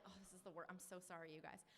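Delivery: small, quiet apologetic aside; sheepish apology. Retold or imitated. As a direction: drop your volume and apologize meekly. Retold apology to friends pushing her.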